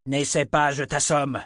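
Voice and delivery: mildly ferocious warning; fierce. A narrator's reading. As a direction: threaten him fiercely. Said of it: "d'un ton féroce".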